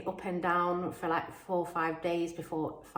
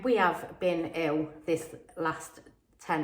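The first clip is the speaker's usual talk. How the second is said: ordinary talk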